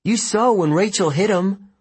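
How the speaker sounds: high-pitched, urgent insistence; insistent. A narrator's reading. Annabeth argues Luke is still there, insistent. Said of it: "she insisted".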